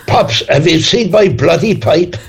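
a hammed-up Cork accent, high and giggly; grandfather's Cork accent. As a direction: squeak out an old Cork grandad's complaint, half laughing. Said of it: "Don't know if that's a Cork accent or not".

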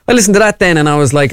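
ordinary talk, nothing marked

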